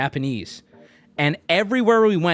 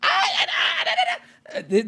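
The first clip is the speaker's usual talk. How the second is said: 1 high squealing shriek; slower than usual. Retold or imitated. Girls' table shrieking refusal.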